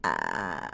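very low, rattling vocal-fry croak; drawn-out creaky "ah" like the Grudge ghost. Shown as a demonstration. Imitating the ghost from The Grudge, low creaky croak. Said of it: "I sound like".

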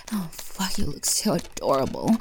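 normal talking voice

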